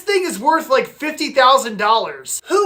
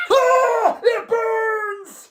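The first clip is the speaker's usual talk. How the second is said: high pained cry; 7 semitones higher, much slower than usual. Acted. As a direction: cry out as it burns. Sprayed with Clorox.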